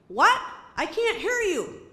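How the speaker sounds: loud what on a bad call; loud phone shout. Can't hear on phone.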